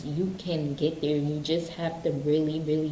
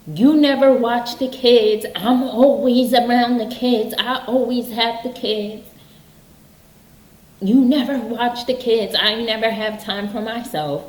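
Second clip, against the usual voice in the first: loud, higher, accusing yell at the spouse; blaming complaint; 6 semitones higher, 8 dB louder than usual. Retold or imitated. Parent blaming spouse over childcare, angry yell. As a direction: hurl the blame, loud and high. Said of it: "instead of saying".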